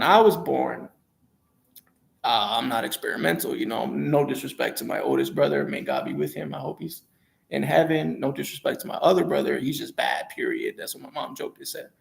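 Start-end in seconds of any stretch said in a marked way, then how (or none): none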